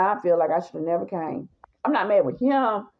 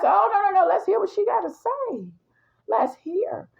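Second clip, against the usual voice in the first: high, sassy insistence; no no, let her talk, high and sassy; 10 semitones higher than usual. Retold or imitated. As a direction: girlfriend stopping him sending the woman away, high and sassy. Stopping him, wants the drama.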